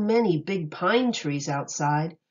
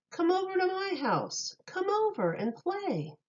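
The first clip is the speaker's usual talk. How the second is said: put-on higher, softer coaxing kid voice; child's invitation; 10 semitones higher, 4 dB quieter than usual. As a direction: call a friend over sweetly, pitched up. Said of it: "you will hear someone say".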